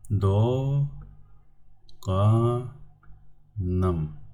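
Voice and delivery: "Do", "ga", "nam" with long pauses; syllable by syllable. Shown as a demonstration. Teacher gives students the answer, "Do, ga, nam" in separate syllables. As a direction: lift "Do" and "ga", settle on "nam". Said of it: "I'm going to do it for you".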